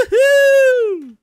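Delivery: high, loud whooping cheer; excited cheer. Real, not acted. Cheering Phil's news tease with a loud whoop.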